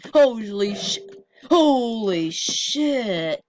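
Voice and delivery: slow, drawn-out stunned holy shit; amazed, letting it stretch out. Real, not acted. Stunned by game event.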